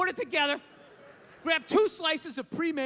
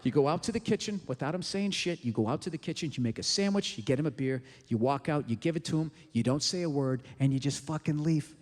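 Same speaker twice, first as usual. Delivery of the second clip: a hushed, deadpan step-by-step in a lower register; low deadpan instructions; 10 semitones lower than usual. Pretend. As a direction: drop the voice low and deliver the steps flat. Instructions delivered low and flat.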